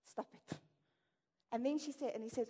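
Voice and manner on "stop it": breathy whispered stop it; unvoiced mock advice; whispered repeat. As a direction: whisper the curt command. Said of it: "That's his advice".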